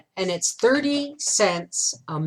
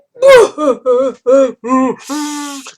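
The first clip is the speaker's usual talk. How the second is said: crying laugh; 8 semitones higher, 11 dB louder than usual. Retold or imitated. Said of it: "So I was a mess".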